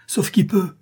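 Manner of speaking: the crowd's cowardly cry of every man for himself; sinister cry. A narrator's reading. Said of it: "ce cri sinistre".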